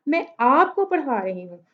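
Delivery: आपको stands out from the rest; stress on आपको. Shown as a demonstration. Teacher shows students whom she teaches, stress on आपको. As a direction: put the emphasis on आपको, not मैं. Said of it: "तो मैं आप पे stress कर रही हूँ".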